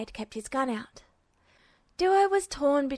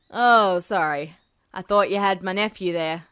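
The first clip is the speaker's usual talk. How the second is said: lower, louder man's voice feigning a mistake; low voice, mock apologetic; Hecht covering; 5 semitones lower, 6 dB louder than usual. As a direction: play it off as an innocent mix-up.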